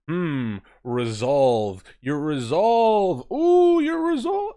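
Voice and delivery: slow, swooning mock emphasis ending high and loud; hammed-up mock drama. Mocking the game's overused word resolve, hammed up. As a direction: ham up the word resolve until it soars. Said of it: "they're laying it on pretty thick".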